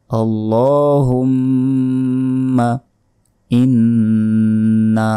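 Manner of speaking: ইন্না very slow, with the nn held nasally; ghunna on inna. Shown as a demonstration. Teacher drilling ghunna in ইন্না. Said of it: "ইন গুণনা হবে".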